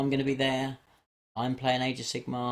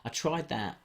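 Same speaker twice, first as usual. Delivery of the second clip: usual speaking voice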